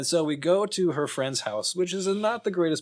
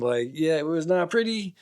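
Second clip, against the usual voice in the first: ordinary talk, nothing marked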